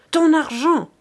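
loud, high yell shaking him; furious shout. A narrator's reading. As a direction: bellow the demand at him. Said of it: "hurlait le forçat en le secouant rudement". (cri furieux)

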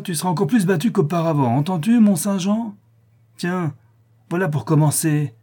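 rising angry mob voices; furious inmates. Acted. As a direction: threaten her like a hostile mob. Inmates menace the girl.

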